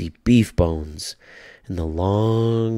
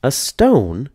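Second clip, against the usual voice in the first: put-on surprised little old lady, pitched higher; lightly voiced old woman, puzzled; 7 semitones higher, 4 dB louder than usual. A narrator's reading. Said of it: "said the little old lady".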